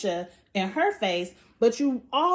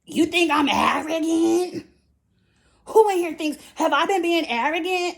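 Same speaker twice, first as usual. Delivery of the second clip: louder, higher offended outburst; 6 semitones higher, 4 dB louder than usual. Retold or imitated. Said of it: "And she was like".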